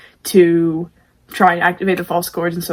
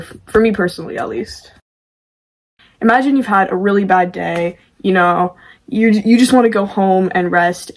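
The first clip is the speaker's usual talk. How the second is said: usual speaking voice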